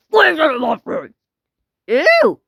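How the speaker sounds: high, loud play yell; pretend outraged shout. Asking about the phone in play, high shout.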